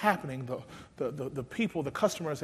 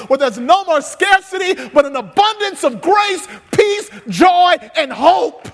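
slow, loud, higher preaching; preaching at full force; 10 semitones higher, 14 dB louder than usual. Acted. Pastor exhorting his church, preaching at full force. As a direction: build the sermon to a shouting climax.

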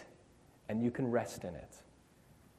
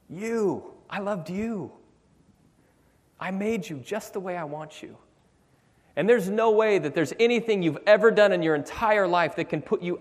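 a raised, pleading voice of a loving God; God begging his people to understand; 8 semitones higher, 6 dB louder than usual. Acted. Preacher voicing God, pleading and raised.

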